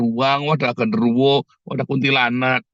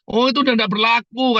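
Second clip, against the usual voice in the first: Pak Topik; 7 semitones higher than usual. Retold or imitated. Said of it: "kata Pak Topik".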